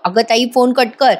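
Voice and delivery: ordinary talk, nothing marked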